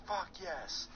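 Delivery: high-pitched but held-back scream; mock scream. Retelling his relief after hanging up, mock scream. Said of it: "I let out the biggest scream".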